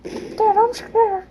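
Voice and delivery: put-on high, pleading kid's voice, slowing into a wail; scared child voice. Kid on the bike begging his dad for help. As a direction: plead like a frightened little boy.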